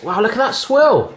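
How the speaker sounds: normal talking voice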